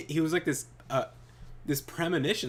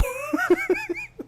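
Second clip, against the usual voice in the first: high shrieking laugh; 7 dB louder than usual. Real, not acted. Laughing at own retelling.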